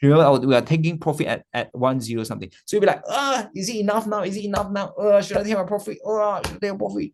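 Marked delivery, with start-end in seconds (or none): 3.1-7.1 high, fretful mock dithering; acted antsy hesitation. Trader unsure about taking profit, antsy. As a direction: imitate someone who can't stand waiting. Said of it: "you can't stand it".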